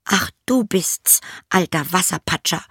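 princess voice. A narrator's reading. Princess speaking to the frog, high and whispery. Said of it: "sagte sie".

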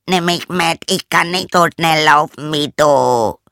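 high, loud frog voice; frog shouting after her. A narrator's reading. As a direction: call out desperately as a left-behind frog. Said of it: "sein Quack, Quack so laut nachschrie".

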